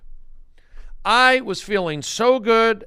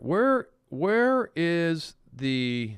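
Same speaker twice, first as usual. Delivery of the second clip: usual speaking voice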